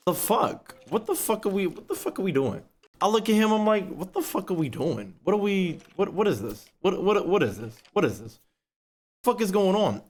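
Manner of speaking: high, stunned what the fuck; appalled, asking what is going on; appalled disbelief. Retold or imitated. Quoting himself appalled at the roommate, high and stunned. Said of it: "I was like".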